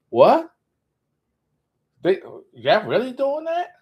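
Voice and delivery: high, loud disbelief; can't believe what fans do; incredulous. Real, not acted. Mocking fans who destroy things.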